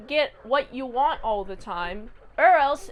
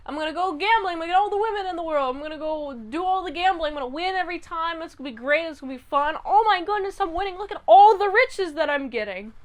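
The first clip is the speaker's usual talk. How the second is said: higher-pitched breathless-winner rush of delight; excited gushing; 4 semitones higher, 4 dB louder than usual. Retold or imitated. Rocky revels in getting whatever he wants, acted excitement. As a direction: gush like you're on a lucky streak. Retold gambler thrilled at endless winning.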